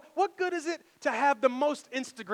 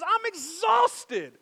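slow, higher, drawn-out tired sigh of words; mock exhausted; 9 semitones higher, 7 dB louder, much slower than usual. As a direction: let it sag like he's worn out. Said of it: "he just says".

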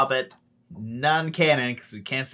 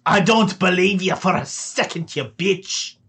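angry halfling; 5 semitones higher, 4 dB louder than usual. Acted. Tavern halfling yelling at intruders in his cellar, angry high shout.

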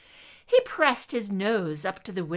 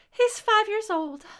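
higher, louder mother's voice; 13 semitones higher, 6 dB louder than usual. Acted. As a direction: answer like a chatty, frazzled mom. Mother answers Scully.

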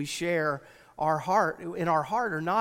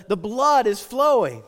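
normal talking voice

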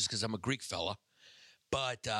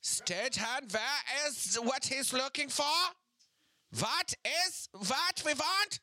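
put-on German accent; 13 semitones higher, slower than usual. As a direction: bark it like a German villain. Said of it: "do a German accent when they scream".

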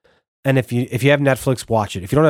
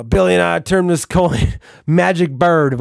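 slow, higher, puffed-up brag in a borrowed voice; 5 semitones higher, slower than usual. Retold or imitated. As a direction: drag it out like a smug rapper pleased with himself. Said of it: "he's this one scene where he's like".

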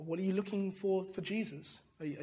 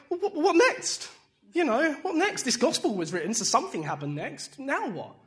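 eager questioning; 8 semitones higher, 8 dB louder than usual. Retold or imitated. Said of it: "they're like".

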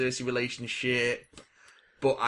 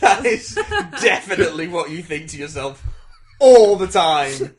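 laughing; 2 semitones higher, 11 dB louder than usual. Real, not acted. Laughing at partner's voice.

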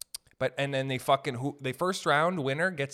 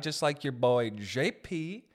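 ordinary delivery

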